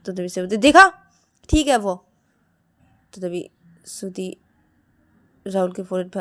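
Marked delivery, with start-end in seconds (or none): 0.6-2.0 loud, pitched-up point scoring; vindicated. Acted. Siya showing Shruti he is fine, vindicated. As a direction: say it as proof you were right.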